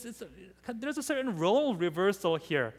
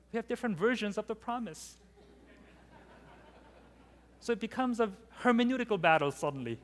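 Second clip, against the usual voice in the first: ordinary talk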